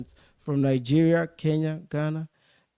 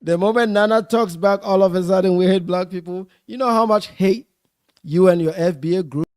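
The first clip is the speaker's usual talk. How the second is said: heated shouting; 3 semitones higher, 8 dB louder than usual. Real, not acted. Heated livestream argument, talking over.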